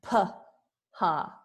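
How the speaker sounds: "p, ha" as puh, pause, ha; teacher breaking up "p, ha"; split syllables. Shown as a demonstration. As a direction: separate "p" from "ha" with a gap. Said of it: "And then the P H A".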